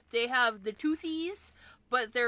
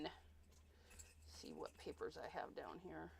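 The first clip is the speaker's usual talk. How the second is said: whisper; 13 semitones lower, 24 dB quieter than usual. Real, not acted. Rummaging under the desk, hushed.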